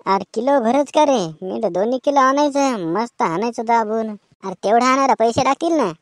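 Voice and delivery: usual speaking voice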